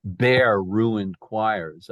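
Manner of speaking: "Bare" punched loud and held apart from "ruined choirs"; stress on BARE. Shown as a demonstration. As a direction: hit "Bare" hard, then pause. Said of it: "there has to be a metrical substitution there".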